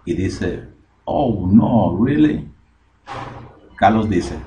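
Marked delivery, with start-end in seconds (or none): 1.0-2.5 raised, incredulous exclamation; dismayed disbelief. Acted. Pedro hearing the dog is on the bed, dismayed disbelief. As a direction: react as if displeased and taken aback. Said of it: "A Pedro no le gustó".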